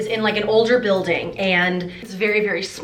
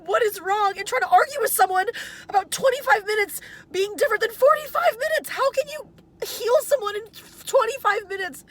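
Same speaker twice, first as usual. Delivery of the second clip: choked-up, close to tears, breaking into crying; venting through tears of frustration; upset and teary, voice catching; tearful venting; 6 semitones higher, 5 dB quieter than usual. Real, not acted. I've been crying.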